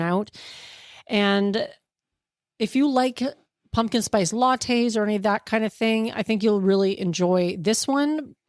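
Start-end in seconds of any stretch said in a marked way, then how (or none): none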